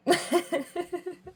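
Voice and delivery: high giggle. Real, not acted. Laughs after demon joke.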